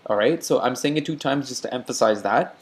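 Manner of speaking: normal talking voice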